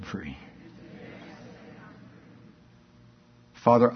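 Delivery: soft, slow prayer lead; guided prayer. Real, not acted. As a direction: lead the room gently in repentance. Leads a prayer.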